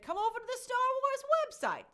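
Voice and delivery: high, loud, sing-out invitation; hammed-up corporate come-on, pitched well up; promotional mimicry. Host mimics Lucasfilm luring visitors online, high mock-promotional invitation. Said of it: "they said".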